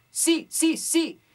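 high loud sealed sí calls; bright, closed-glottis sí; compressed sí. Shown as a demonstration. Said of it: "podrías hacer". (sí comprimido y agudo)

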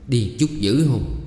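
ordinary delivery, nothing marked

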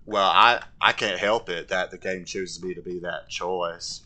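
put-on simple southern man's accent; Forrest Gump. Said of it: "I gotta talk like Forrest Gump".

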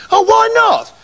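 high, whining, insistent nagging; child-like nagging, relentlessly repeated; pestering son. A narrator's reading. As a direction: keep pestering Dad in a high whine. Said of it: "Hideous wouldn't stop asking".

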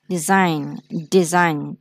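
Design echoed with a clean falling close; teacher echoes the recording for students; repeat after model. Shown as a demonstration. Teacher repeats the word after the recording, falling read. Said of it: "mấy bạn có nghe chữ in không ha".

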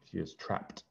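hushed, breathy story whisper. A narrator's reading. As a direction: whisper it like a scary secret. Suspense in story.

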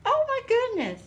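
high, loud gasp of delight; loud high oh my goodness; surprised exclamation. Real, not acted. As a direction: burst out in amazed delight. Opening a gift package.

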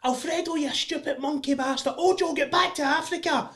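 high-pitched mock heckling; abusive football fan. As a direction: imitate an irate fan jeering from the stands. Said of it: "All I hear is".

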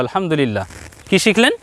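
ordinary talk, nothing marked

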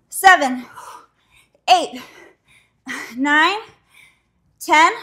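loud rep count; counting reps loudly. Real, not acted. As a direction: call out each rep. Coach counting reps aloud.

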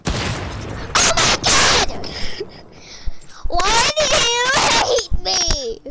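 high, drawn-out shout of frustration; exasperated wail. Real, not acted. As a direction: wail it out as if persecuted. Wails at bad luck in game.

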